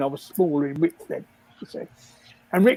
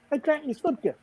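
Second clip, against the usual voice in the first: usual speaking voice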